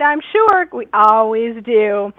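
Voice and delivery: ordinary talk, nothing marked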